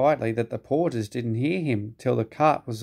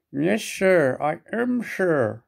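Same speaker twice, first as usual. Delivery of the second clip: muffled; 6 semitones higher, slower than usual. A narrator's reading. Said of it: "a muffled voice replied".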